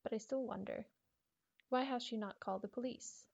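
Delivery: usual speaking voice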